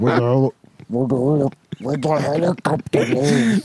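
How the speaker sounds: worse marbles mouth voice. Pretend. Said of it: "You're only doing it worse".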